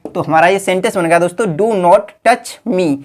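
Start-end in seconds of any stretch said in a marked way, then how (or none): 1.5-3.0 each word drawn out for copying; slow dictation. Shown as a demonstration. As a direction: dictate it slowly to the class. Teacher dictates the English sentence. (धीमी गति से लिखवाना)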